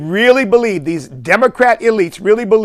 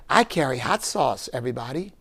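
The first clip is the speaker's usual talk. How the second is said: mock Hillary; 5 semitones lower, 10 dB quieter than usual. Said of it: "Just tell them".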